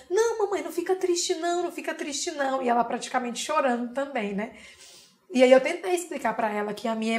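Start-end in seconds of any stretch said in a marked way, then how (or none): 0.1-2.6 put-on high child's voice, fading softer; a little girl begging mum not to be sad; consoling little girl. Mother voicing daughter consoling her. (menina pequena consolando, cada vez mais baixo)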